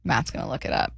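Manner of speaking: breathy aside to the producer, no voice; whisper. Real, not acted. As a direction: whisper Matt's gonna look it up aside to the back room. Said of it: "She's whispering to the back room".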